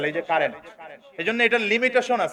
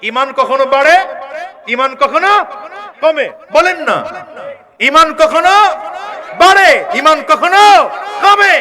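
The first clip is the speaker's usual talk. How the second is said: building from loud to very loud, higher and higher; rising preacher call; 7 semitones higher, 9 dB louder than usual. Real, not acted. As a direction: rouse the crowd, climbing each phrase. Preacher prompting crowd to repeat.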